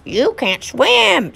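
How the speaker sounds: squeaky rubber duck. Acted. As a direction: quack back stubbornly. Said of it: "said the duck".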